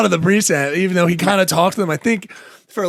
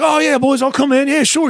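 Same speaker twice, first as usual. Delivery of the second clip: high, breezy fake innocence; mock innocent Gacy; 8 semitones higher, 3 dB louder than usual. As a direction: play him as a cheerful suspect with nothing to hide. Gacy acted as the innocent suspect.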